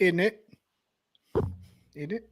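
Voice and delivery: a light Dublin Irish lilt, each rising like a question. Retold or imitated. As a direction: mimic the Dublin Irish upturn at the end. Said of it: "that lilt at the end ... like a freaking question".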